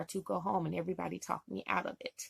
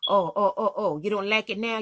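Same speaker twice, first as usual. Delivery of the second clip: mock going off; 6 dB louder than usual. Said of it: "he just started going off".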